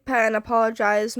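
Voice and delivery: ordinary delivery, nothing marked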